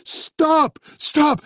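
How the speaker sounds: high desperate yell; shouting over engine. Acted. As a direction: yell to stop the bus. Jimmy yelling at bus.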